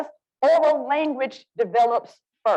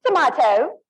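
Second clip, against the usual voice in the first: British "tomato" with "mah" in the middle, rising; British accent; 3 semitones higher, 3 dB louder than usual. Shown as a demonstration. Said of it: "But if I were British".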